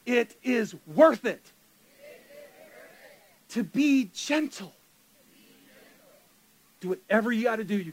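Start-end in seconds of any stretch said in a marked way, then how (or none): 0.1-4.7 slow, spelled-out phrase for the crowd to repeat; call and response. Shown as a demonstration. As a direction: say it slowly so they can follow. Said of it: "say it with me".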